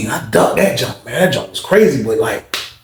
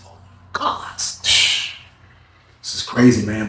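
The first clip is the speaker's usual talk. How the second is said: slow, breathy whisper; voicing a stunned young inmate, whispered; awed whisper; 5 semitones lower, 5 dB quieter, much slower than usual. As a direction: whisper in shock. Stunned reaction.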